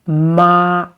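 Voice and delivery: "ma" held long and level; flat, sustained "ma"; first tone. Shown as a demonstration. Teacher contrasting hemp with mother, level "ma". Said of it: "first tone because it is flat".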